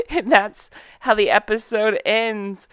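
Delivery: normal talking voice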